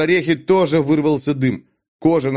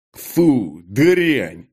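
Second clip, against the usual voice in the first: drawn-out, emphatic disgust. Acted. Dag after drinking the sorcerer's blood, disgusted. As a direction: spit it out in revulsion.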